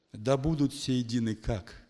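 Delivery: ordinary talk, nothing marked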